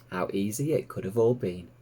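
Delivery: ordinary delivery, nothing marked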